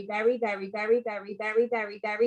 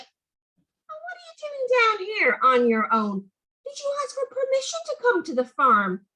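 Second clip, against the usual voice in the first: mock-cross daddy; 11 semitones higher than usual.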